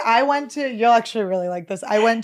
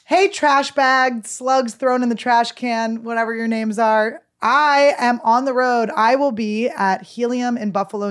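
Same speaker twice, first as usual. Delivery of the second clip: 1 loud tour plug; 3 semitones higher, 5 dB louder than usual. Acted. Tour dates read as a plug.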